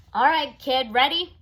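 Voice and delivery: put-on loud, impatient call to the kid; loud, hurried cameraman voice; brisk cameraman. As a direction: bark it across the set. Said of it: "The cameraman said".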